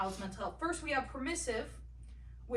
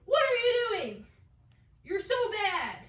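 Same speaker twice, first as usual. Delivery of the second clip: angry parent yelling; 9 semitones higher, 8 dB louder than usual. Acted. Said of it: "or yells at them saying".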